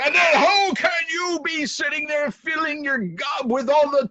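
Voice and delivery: furious king. Acted. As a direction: tear into the servant.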